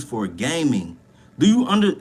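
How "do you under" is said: louder, higher, amazed and fired up; hyped up. Real, not acted. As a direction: sell the wonder of it to the viewers. Hyped about gamers becoming millionaires.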